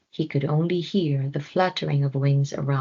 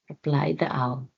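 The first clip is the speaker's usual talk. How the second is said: ordinary delivery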